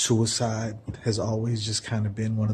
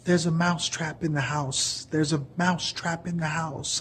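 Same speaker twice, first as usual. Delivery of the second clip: a panicked mouse yelling, toned down; held-back mouse scream; 6 semitones higher than usual. Acted. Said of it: "he was screaming".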